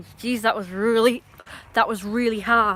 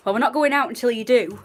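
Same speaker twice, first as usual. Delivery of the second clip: raised, firm scolding; real exasperated scolding; scolding the dog; 4 semitones higher, 7 dB louder than usual. Real, not acted. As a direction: lay down the rule to the dog. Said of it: "I'm yelling at the dog".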